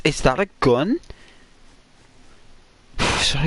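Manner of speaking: ordinary delivery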